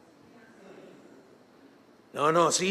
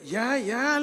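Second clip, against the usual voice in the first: higher, strained 'enough' through a laugh; mock straining plea; 5 semitones higher than usual. Telling her the man was slipping, mock strain. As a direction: act out struggling under a heavy load.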